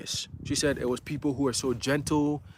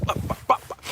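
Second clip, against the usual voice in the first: normal talking voice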